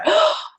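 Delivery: high sudden gasp; sharp gasp. Retold or imitated. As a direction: gasp as if struck by an idea. Mock realization in retold scene.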